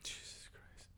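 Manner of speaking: under-breath Jesus Christ; hushed stunned aside; whispered disbelief. Real, not acted. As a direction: breathe it out, shocked. Listener reacts to the story.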